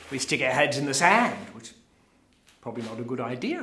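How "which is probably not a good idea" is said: quick, low and soft; offhand aside. Acted. Speaker judges hiding heads in sand, offhand aside. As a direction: toss it away as a passing remark. First take, corrected for a joke.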